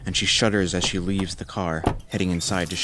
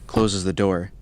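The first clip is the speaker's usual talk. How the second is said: ordinary delivery, nothing marked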